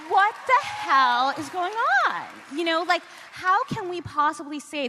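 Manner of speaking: exasperated outburst. Real, not acted. Author to the interviewer, loud exasperated outburst. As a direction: let the outrage burst out.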